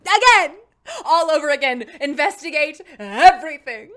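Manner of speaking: loud excited shouting. Real, not acted. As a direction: shout it out with glee. Excited shouting about replaying.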